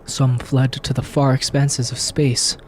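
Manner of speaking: low, grave trailer narrator voice; dramatic narration. A narrator's reading. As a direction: read it like an epic movie intro. Reads game intro as narrator.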